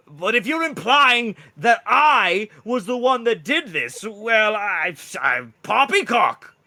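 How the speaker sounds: loud, high, drawn-out indignation; sputtering, theatrical protest; defensive bluster. Acted. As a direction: stretch out the outraged denial, voice way up. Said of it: "You're being really defensive right now".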